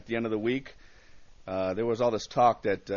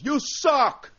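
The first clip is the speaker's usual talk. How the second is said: high, loud imitated heckle; heckler's yell; 7 dB louder than usual. Guy in the bar heckles the comic climbing up, yelling. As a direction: yell it out from the crowd. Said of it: "this guy yells".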